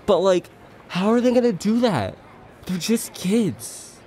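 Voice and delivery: high-pitched incredulous movie fan; worked-up disbelief. Acted. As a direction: marvel at it, stretching the last words. Acted movie fan marvels at plot.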